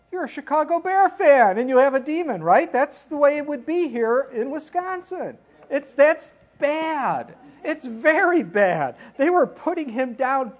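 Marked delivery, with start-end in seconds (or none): 0.1-9.0 joking outrage. Real, not acted. Joking Chicago Bears fan insult in Wisconsin.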